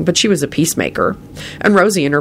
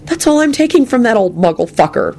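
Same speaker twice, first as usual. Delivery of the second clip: higher, contained anger; angry thought; 7 semitones higher than usual. A narrator's reading. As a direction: think it angrily to yourself. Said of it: "Lupin thought angrily".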